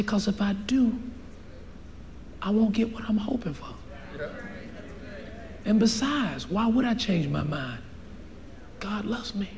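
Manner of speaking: soft, steady reply; quiet resolve. Retold or imitated. Preacher answering demons in tears, quiet resolve. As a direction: answer the doubt gently but firmly.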